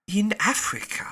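IN lifted high in wonder; wondering repeat. Acted. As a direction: Passepartout echoing Fix, marvelling at being in Africa. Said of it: "repeated Passepartout".

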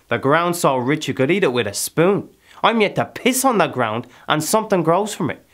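loud, high, impassioned boast; fierce boasting. Acted. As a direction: proclaim your hard-won riches at full voice. Character boasts heatedly of his land.

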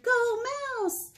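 high cheering mom. Acted. Mom cheering her daughter at soccer, high cheer. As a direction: cheer from the sidelines. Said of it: "Mom cheered".

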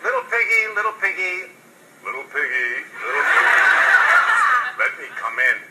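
lightly voiced wolf, mock-polite and sweet; a wolf sweetly sweet-talking to get in the door. Wolf at the door coaxing the pig, mock polite. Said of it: "his most polite voice".

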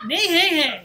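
loud, high mock horse neigh; whinny like a silly horse. Teacher neighing for his three-legged horse, playful. I like to make noises when I draw stuff.

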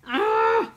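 high, loud shocked gasp; startled gasp of dismay; sharp gasp. Real, not acted. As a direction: gasp as it goes wrong. Mistake while punching.

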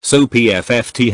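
ordinary delivery, nothing marked

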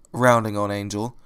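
ordinary delivery, nothing marked

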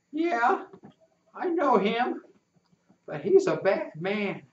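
put-on high boyish voice, a bit wary; a kid wary of a grown man; uneasy boy. Rufus warns about Snapper, lightly voiced uneasy boy.

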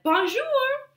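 high, bright sing-song bonjour; cheerful greeting. Acted. Zookeeper's son greets the lion in a high cheery voice.

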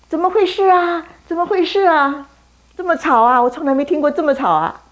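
high, loud, bewildered asking; a blind man startled by a noisy crowd; puzzled loud cry. Acted. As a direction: call out puzzled at the racket. Blind man wondering at the noise.

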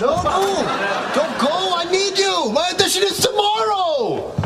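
desperate pleading. Real, not acted.

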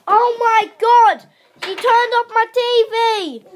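loud, high, outraged cry; shocked shout. Real, not acted. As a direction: yell it in real disbelief. Sister just turned off TV.

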